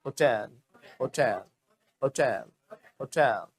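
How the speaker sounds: "होटल" as ho-TEL, end stressed; model pronunciation. Shown as a demonstration. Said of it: "क्या बोलना है?".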